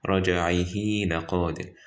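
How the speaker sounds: "رجعه" ending in a long held hee; stretched recitation. Shown as a demonstration. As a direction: draw out the final i of "رجعه" into "لقادر". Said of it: "that you stretch it".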